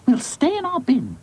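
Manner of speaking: lightly voiced high doll; doll character voice. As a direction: agree in a high doll's voice.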